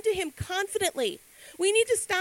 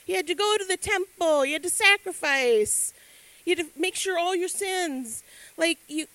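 ordinary delivery

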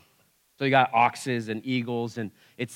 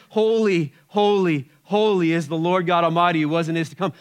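holy repeated with rising fervour, louder; fervent praise; 3 semitones higher, 4 dB louder than usual. Retold or imitated. Creatures praising God on the throne, fervently. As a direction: proclaim it as praise. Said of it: "same amount of praise".

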